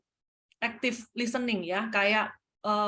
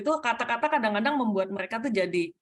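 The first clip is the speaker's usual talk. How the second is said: normal talking voice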